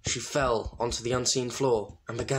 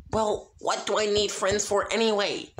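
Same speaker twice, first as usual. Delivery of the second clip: shouted; 9 semitones higher than usual. A narrator's reading. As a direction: hurl it back at the judges. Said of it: "Sunset shouted".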